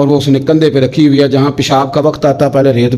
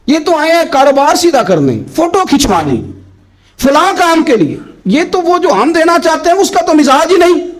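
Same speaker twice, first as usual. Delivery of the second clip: preaching peak; 12 semitones higher than usual. Acted. Preaching peak against fake seekers.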